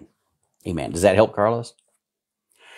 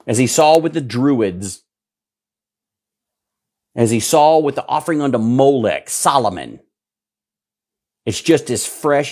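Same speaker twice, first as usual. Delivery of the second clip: raised, higher, louder sermon voice; full-force pulpit preaching, higher and louder; fiery preaching; 5 semitones higher, 3 dB louder than usual. Real, not acted. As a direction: preach it with mounting alarm at the horror.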